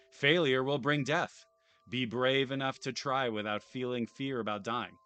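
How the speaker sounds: announcer-style reading of the game's intro; dramatic read-aloud. Acted. Streamer reading the intro text to viewers, mock announcer. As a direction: boom it out like a trailer voiceover.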